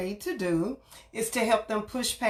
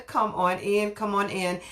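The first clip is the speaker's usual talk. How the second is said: ordinary talk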